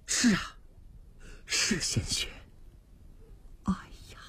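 hushed, breathy dread; awed whisper. Acted. Official whispering in dread over the blood. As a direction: whisper it as if the sight chills you.

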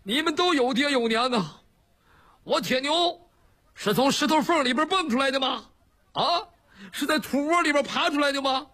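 high-pitched, hammed-up tearful lament; tearful wailing complaint. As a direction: wail it like an aggrieved son missing his mother. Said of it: "你哭什么呀？".

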